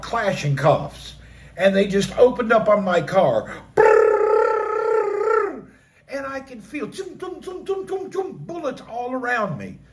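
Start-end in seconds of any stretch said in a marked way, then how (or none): 3.7-5.7 long, loud, high-pitched rolled burr of gunfire; imitated automatic gunfire. Imitating gunfire in war story. 6.9-8.3 high, hushed repeated zips; soft, quick bullet noises made with the mouth; imitated whizzing bullets. Imitating bullets passing around him.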